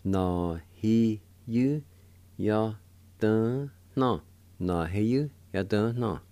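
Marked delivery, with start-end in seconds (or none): 0.0-4.2 whole word in slow, separated syllables; syllable-by-syllable demo. Shown as a demonstration. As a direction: give every syllable its own beat. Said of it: "And together".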